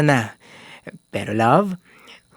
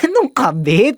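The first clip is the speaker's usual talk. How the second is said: surprised; 9 semitones higher, 4 dB louder than usual. Acted. Baffled by the accusation.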